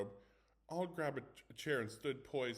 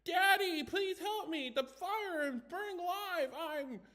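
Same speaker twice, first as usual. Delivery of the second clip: much higher, louder hammed-up child pleading; mock child's voice; 8 dB louder, slower than usual. Teen taunting friends with the ghost boy's cries, hammed-up. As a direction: whine like the trapped boy, mocking. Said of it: "With a smirk, he continued".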